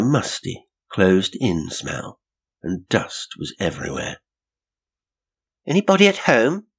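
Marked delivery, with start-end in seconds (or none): 5.7-6.7 high, loud call into an empty house. A narrator's reading. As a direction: call out to see if anyone's home. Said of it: "she called".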